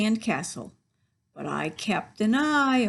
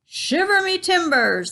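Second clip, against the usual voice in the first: loud, higher pirate exclamation; hammed-up pirate oath; pirate captain; 9 semitones higher, 7 dB louder than usual. A narrator's reading. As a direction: bellow it like a startled captain. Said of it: "the pirate said".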